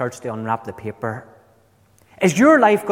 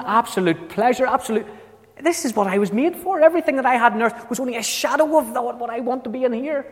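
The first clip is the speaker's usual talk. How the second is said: pitched up, longing to stay; high yearning plea; 8 semitones higher than usual. Pretend. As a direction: plead high and eager for heaven.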